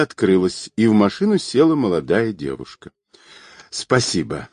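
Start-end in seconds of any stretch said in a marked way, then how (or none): 3.7-4.5 lower, lightly winded thanks; a touch of breathlessness; out of breath. A narrator's reading. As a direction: say it as if catching your breath. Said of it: "запыхавшись, сказала она".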